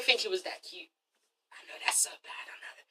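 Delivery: whispered confession, barely audible; guilty whisper. Real, not acted. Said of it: "confessing a mean thought".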